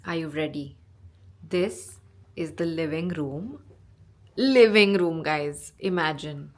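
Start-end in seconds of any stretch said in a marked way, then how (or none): 4.4-5.2 loud, thrilled outburst; excited exclamation. Real, not acted. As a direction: burst out with it, much louder. Emphatic repeat in room tour.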